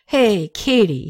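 put-on slow, drunken slur; tipsy friend slurs a greeting to Katie; slurred speech. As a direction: drag the words like you've had too much. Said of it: "he said with a slur in his speech".

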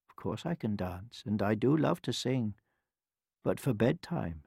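put-on soft, calm old woman; hushed elderly kindness; gentle old woman. A narrator's reading. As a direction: answer quietly and reassuringly. Old woman calmly answers the royals.

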